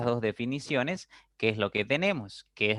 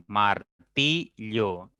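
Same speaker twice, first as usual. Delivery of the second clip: said slowly; much slower than usual. Shown as a demonstration. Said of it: "con golpes de voz pausados".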